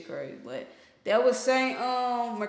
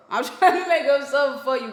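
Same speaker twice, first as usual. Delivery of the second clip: laughing through it, louder and quick; 4 semitones higher, 7 dB louder, faster than usual. Real, not acted. Reactor explaining the song to viewers, laughing. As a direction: crack up mid-sentence and push on fast.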